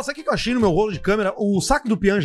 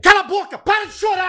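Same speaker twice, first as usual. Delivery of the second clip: high, loud mock yell at a crying kid; 11 semitones higher, 3 dB louder than usual. Pretend dad shouting at his sobbing son, mock yell. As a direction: bark it like a fed-up dad, pitched high.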